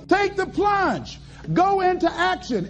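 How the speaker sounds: each word slow and deliberate: "Take the plunge"; slow, weighty urging. Shown as a demonstration. Speaker slowly urges the audience to take the plunge.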